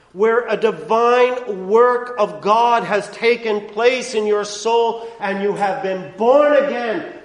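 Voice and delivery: loud, rising pulpit preaching; impassioned preacher; full-force preaching. Acted. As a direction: drive the law's demands home from the pulpit. Builds to climax.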